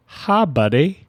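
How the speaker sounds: imitating an awkward shop owner's set phrase; stock shop-owner greeting. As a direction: mimic a one-note "buddy" greeting, lifted at the end. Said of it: "he says it just like that".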